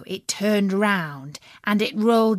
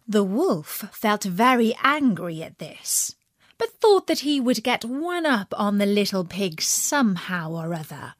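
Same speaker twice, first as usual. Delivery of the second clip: plain reading voice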